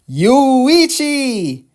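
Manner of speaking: high, loud delighted shout of a name; hammed-up cheerful yell; excited call. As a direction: call him out with glee. Sister calls out excitedly.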